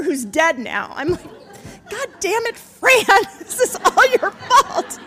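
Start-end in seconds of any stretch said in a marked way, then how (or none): 1.9-5.0 high mock-angry outburst breaking into laughter; cursing her mother. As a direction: curse and blame her, half laughing. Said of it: "I am cursing my mother".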